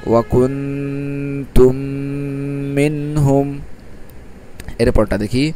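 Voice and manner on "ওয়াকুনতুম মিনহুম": ওয়াকুনতুম মিনহুম drawn out very slowly with the nasals held; ওয়াকুনতুম মিনহুম chanted in slow tajweed style; Quranic recitation with ghunnah. Shown as a demonstration. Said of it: "তাহলে কি হলো?".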